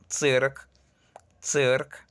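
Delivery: "circ" said with a ts start, tsirk; tsirk, hard ts at the front; Russian цирк. Shown as a demonstration. Teacher models the Russian word, tsirk with ts. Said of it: "Ejemplo, la palabra circo".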